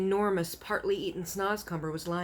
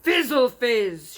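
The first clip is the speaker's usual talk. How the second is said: slow, loud giant shout; furious giant shout; 6 semitones higher, 11 dB louder, slower than usual. A narrator's reading. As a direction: bellow it at him. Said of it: "shouted the blood bottler".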